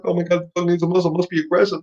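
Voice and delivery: quick, high mock alarm at the muzzle; mock panic. Mocking owners who fear the muzzle, high and quick. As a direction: play the nervous owner fretting, hammed-up. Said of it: "'cause we're like".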